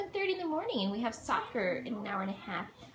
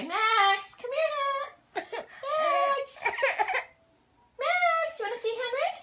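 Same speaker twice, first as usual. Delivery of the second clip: high call to dog; 10 semitones higher than usual. Real, not acted.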